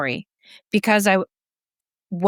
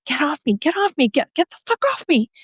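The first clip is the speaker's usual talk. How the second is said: higher, frantic retold cry to get off; panicked pushing away; 8 semitones higher than usual. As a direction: relive the panic of shoving him away. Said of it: "I, like, freaked out".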